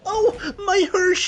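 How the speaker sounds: high, slow, startled squeal of surprise; surprised squeal. Real, not acted. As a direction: shriek it out, caught off guard. Surprised exclamation while playing.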